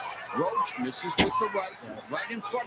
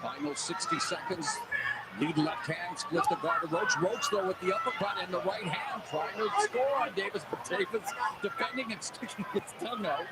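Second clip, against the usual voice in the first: golf commentator; 9 dB quieter than usual. Acted. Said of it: "golf commentator voice".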